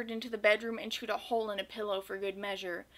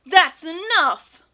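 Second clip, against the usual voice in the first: loud, slow angry roar; 7 semitones higher, 16 dB louder, much slower than usual. A narrator's reading. Gus has had it with Mouse, roared. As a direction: roar it out, drawn out and higher. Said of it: "pointed his finger at Mouse and roared".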